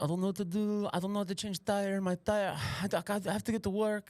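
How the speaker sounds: high-pitched, worried Russian lady. Acted. Russian lady with a flat on the way to work, fretting high. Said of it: "she's like, this Russian lady".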